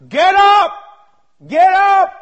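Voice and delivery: loud, high cry of get up; shouted command. Acted. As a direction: bark it at the whole room. Preacher commanding the congregation.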